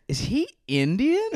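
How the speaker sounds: high-pitched quote breaking into laughter; retold question, laughing. Comic retelling a prank call to his friend, laughing. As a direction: crack up while imitating the question.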